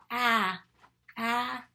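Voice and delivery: ah as the vowel in apple, said loudly; short A sound. Shown as a demonstration. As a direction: punch out ah like the start of apple. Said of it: "The next one is our short sound".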